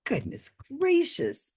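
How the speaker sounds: high, soft exclamation; mock amazement. YouTuber reacts to psychic's logic, mock-amazed. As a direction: marvel in a small high voice.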